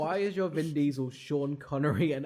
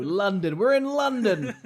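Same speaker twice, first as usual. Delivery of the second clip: excited shout; 4 semitones higher, 8 dB louder than usual. Retold or imitated. Vin excitedly announcing London.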